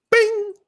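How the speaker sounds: high, short imitated bing; showing viewers the sound of hitting like. Shown as a demonstration. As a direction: make the little ding of a notification. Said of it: "demonstrating the like sound".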